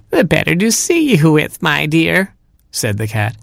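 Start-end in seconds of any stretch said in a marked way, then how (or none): 0.1-2.3 high, sugary fake granny; cat posing as grandma. Cat reassures Robin about big ears, high mock-granny voice. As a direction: coax the child in a high granny voice.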